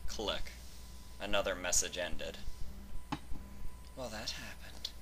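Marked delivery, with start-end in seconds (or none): 4.0-4.9 softer, dry inner remark; inner thought. A narrator's reading. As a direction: think it to yourself, deadpan. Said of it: "Octavia thought".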